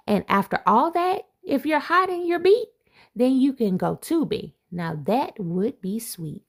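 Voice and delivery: lively picture-book read-aloud; reading to children. Acted. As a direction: read it like a bedtime rhyme to kids. Reads rhyming picture book aloud.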